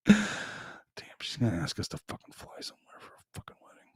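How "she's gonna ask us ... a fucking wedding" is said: hushed, fast, annoyed muttering under the breath; whispering a complaint about the wedding trip; whispered grumble. Real, not acted. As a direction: grumble it quickly to yourself. Grumbling to himself about travel.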